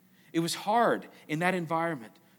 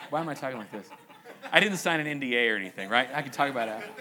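amused, words carried on real laughter; laughing while talking; 4 semitones lower, 3 dB louder, much faster than usual. Real, not acted. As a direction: talk through the giggles at yourself. Laughing at his own coyness.

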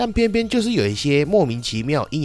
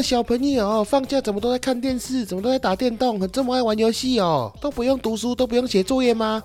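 much higher, sing-song scolding relative voice; nagging relative; 11 semitones higher than usual. Retold or imitated. A busybody relative nagging the kid about video games. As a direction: whine it like a busybody aunt. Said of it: "在那边，哼".

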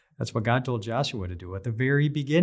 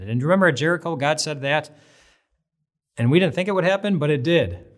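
excited recollection; 6 semitones higher, 6 dB louder than usual. Acted. Israelites recalling God's promises.

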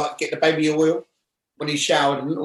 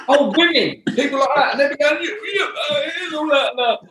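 high, loud, laughing through words; 12 semitones higher, 5 dB louder than usual. Real, not acted. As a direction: talk while cracking up. Laughing through retold scene.

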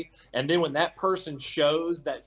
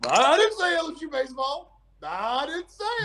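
high, loud mocking imitation of a friend's denial; mocking the co-host's innocent act; 8 semitones higher, 3 dB louder than usual. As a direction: parrot his innocent denial, hammed-up. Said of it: "you're like".

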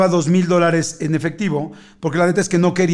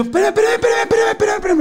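much higher, frantic repeated pleas; panicked pleading; faster than usual. Retold or imitated. Shopper begging the clerk to stop the card charge, panicked. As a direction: beg the clerk to stop, voice shooting up. Seeing the thirty-thousand charge.

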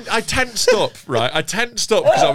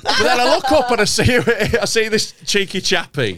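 talking through laughter, pitched up; laughing while talking; 7 semitones higher than usual. Real, not acted. Laughs heard while retelling.

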